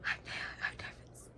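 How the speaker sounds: soft anxious whisper; nervous whisper. Real, not acted. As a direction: breathe it out nervously. Anxious while reading.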